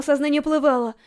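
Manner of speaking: ordinary delivery, nothing marked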